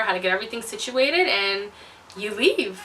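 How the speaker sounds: usual speaking voice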